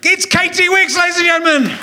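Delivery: big emcee reveal of the guest; announcer shout. Acted. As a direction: belt out the name like a game show host. Host announcing guest name.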